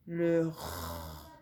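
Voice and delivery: "R" as a long French throat r, softly; letter sound held. Shown as a demonstration. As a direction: hold the rasped sound of "R", not its name. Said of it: "va faire res".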